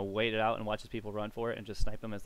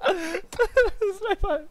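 laughing wail; 10 dB louder, much slower than usual. Real, not acted. Laughing hard at game chaos.

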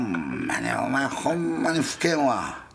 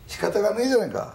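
higher-pitched Edokko retort; Tokyo Edokko accent; 8 semitones higher, faster than usual. Acted. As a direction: answer in rough Tokyo dialect. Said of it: "チャキチャキの江戸っ子だと思ってんだよ".

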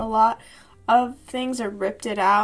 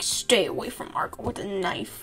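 menacing whisper. Acted. Voicing menacing comic boy.

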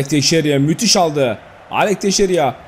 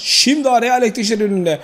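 ordinary talk, nothing marked

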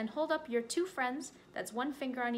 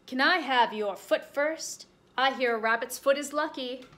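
sly bear; 3 semitones higher, 8 dB louder than usual. Acted. Bear asking rabbit for its lucky foot, loud coaxing. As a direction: wheedle for the foot.

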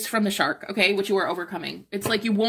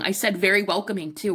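normal talking voice

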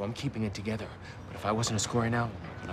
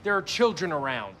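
loud high protest; exasperated; 5 dB louder than usual. Acted. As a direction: throw up your hands vocally.

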